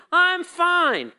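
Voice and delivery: very high, loud chirpy fake cheer; church answer. Pastor mocks the church's fake-happy reply, chirpy. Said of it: "'Cause I'm used to the church answer".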